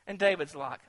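ordinary talk

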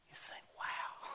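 breathy awed wow; awed whisper. Real, not acted. Preacher reacting to Paul's zeal, hushed. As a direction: let the wonder hush you.

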